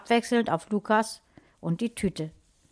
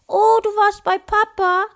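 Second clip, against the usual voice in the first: put-on very high, loud child voice; high-pitched childish dismay; disappointed little sister; 13 semitones higher, 8 dB louder than usual. A narrator's reading. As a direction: squeal it as a let-down little girl. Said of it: "machte ein enttäuschtes Gesichtchen".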